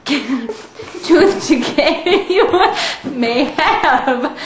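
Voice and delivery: laughing through the words; giggling while explaining. Real, not acted.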